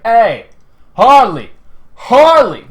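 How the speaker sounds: loud imitated shout of her name; calling out. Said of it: "He's like".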